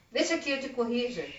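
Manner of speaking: normal talking voice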